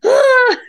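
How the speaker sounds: high, loud teary laugh-cry; half laughing, half crying ah; overwhelmed cry. Retold or imitated. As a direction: let the relief burst out. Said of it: "I just was like".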